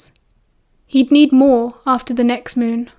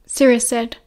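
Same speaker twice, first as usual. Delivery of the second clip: usual speaking voice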